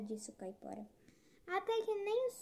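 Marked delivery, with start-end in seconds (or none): 1.5-2.3 high-pitched character voice; boasting tortoise. A narrator's reading. Tortoise brags to the Caipora about not sweating, high-pitched. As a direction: toss it off in a high little voice.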